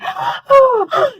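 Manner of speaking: high, imitated panicked gasp; panic-attack gasp. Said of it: "completely had a panic attack".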